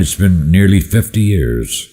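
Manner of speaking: plain reading voice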